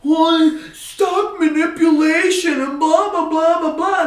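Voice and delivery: high, mock whiny complaining voice; mocking whiny hedge funds. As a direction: mimic a sniveling loser in a high voice. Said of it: "and they're crying".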